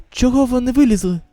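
normal talking voice

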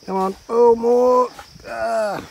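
loud strained urging; tug of war. Real, not acted. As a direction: strain as you pull. Pulling child out of mud.

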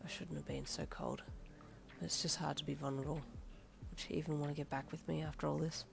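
low, soft brooding; quiet inner thoughts. A narrator's reading. Darby alone after police leave, low soft regret. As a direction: think it to yourself, regretful.

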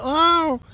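high puppy yelp, imitated; dog yelp imitation. Host imitating the dog's cry for viewers, high yelp. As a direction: yip like a hurt little dog. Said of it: "y de repente hizo".

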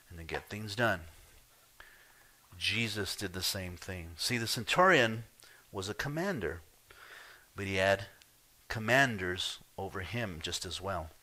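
ordinary talk

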